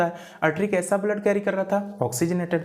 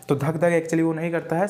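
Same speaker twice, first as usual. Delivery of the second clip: ordinary delivery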